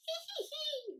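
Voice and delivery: very high, drawn-out acted hee-hee laugh; wheezing giggle. A narrator's reading. Tickled dragon helpless on the ground, high wheezy giggling. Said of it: "wheezed the dragon".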